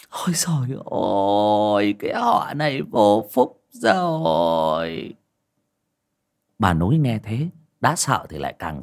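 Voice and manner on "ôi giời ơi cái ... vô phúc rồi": slow lament. A narrator's reading. Aunt lamenting the doomed clan.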